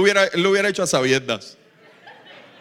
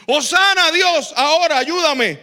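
demanding plea; 9 semitones higher, 6 dB louder than usual. Retold or imitated. Self-serving believer begging God.

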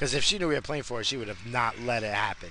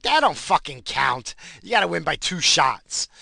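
indignant retort; 5 semitones higher, 8 dB louder than usual. Retold or imitated. Son disputing his father's call on the court, loud indignant retort. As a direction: argue the call, punching each word. Acted argument with father over score.

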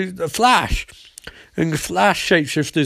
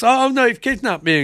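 a raised, lightly hammed child voice; a hint of a whiny kid; mock little kid; 8 semitones higher than usual. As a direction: play the girl faking distress. Little kid voiced fake crying.

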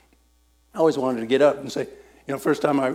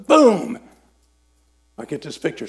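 ordinary talk

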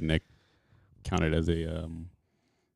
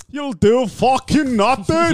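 much higher, louder, slow Irish shout; Irish McGregor shout; 3 dB louder, slower than usual. Pretend. Friend tries the McGregor Irish line, shouting. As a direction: bellow it like a drunk McGregor. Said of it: "That was pretty good".

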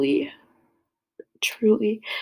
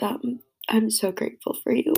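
ordinary talk

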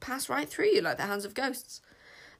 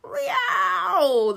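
high loud yowling shout; animal yowl; 10 semitones higher, 8 dB louder than usual. A narrator's reading. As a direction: yowl like a wild cat. Creature cry in story.

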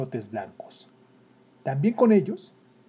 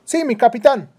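put-on high, loud boyish reply; young drummer boy; 9 semitones higher, 10 dB louder than usual. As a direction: answer like an eager young soldier. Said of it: "respondió".

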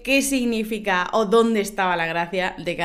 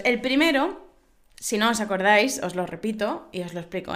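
ordinary delivery

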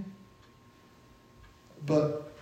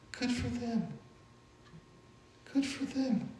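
quiet high good for them; gently glad for friends' blessing; soft sincere blessing; 10 semitones higher, 12 dB quieter, slower than usual. Shown as a demonstration. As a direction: hush it, meaning it. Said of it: "God has blessed them".

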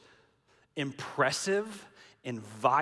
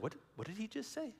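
bewildered muttering; 2 semitones lower, 13 dB quieter, much faster than usual. Retold or imitated. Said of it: "the disciples are like".

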